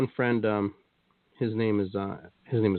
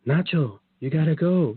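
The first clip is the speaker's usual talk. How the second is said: a higher, lightly voiced woman calling a name; woman's voice; 7 semitones higher, 3 dB louder, slower than usual. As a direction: retell a woman's voice waking you, pitched up. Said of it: "like, a woman's voice".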